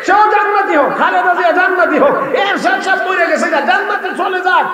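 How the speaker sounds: high-pitched run of blessings; fervent preaching. Real, not acted. Preacher wishes paradise on rival leaders, high fervent preaching. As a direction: pitch each wish up high, one after another.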